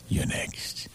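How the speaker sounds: hushed aside; whispered. Acted. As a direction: whisper it ominously.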